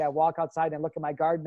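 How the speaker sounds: usual speaking voice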